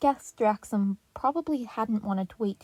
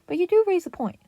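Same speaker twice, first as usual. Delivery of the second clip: excited; 9 semitones higher, 8 dB louder than usual. A narrator's reading. Donnie excited.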